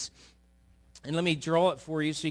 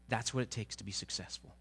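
calm quiet reply; 6 semitones lower, 9 dB quieter than usual. Acted.

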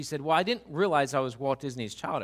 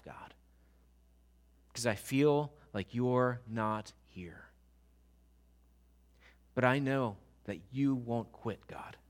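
whispered plea; 4 semitones lower, 5 dB quieter than usual. Acted. Prayer lament whispered to God.

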